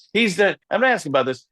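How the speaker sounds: ordinary talk, nothing marked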